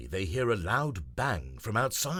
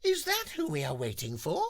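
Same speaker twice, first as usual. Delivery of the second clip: Nelly voice; 6 semitones higher than usual. Acted. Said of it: "Nelly looks up and says".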